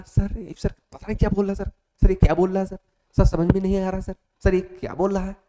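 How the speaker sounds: imitating a panicked candidate who can't follow English; mock confused candidate. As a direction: play a lost cadet begging sir to explain. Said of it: "तो ये तो नहीं हो कि sir, अब English में बोल रहा है".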